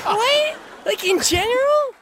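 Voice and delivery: high-pitched, affronted questioning; shocked disbelief. Acted. Worker told her voice is horrible, shocked disbelief. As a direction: ask it high, stunned by the insult.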